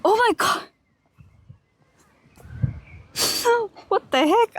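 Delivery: high, startled yelp of surprise. Real, not acted. Streamer startled by a goose call, high surprised yelp. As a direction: jump out of your skin, laughing.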